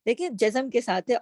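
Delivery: ordinary talk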